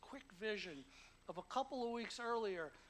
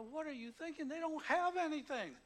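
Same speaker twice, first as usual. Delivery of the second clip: incredulous objection; 7 semitones higher, 8 dB louder than usual. Retold or imitated. Guy objecting to prison collection.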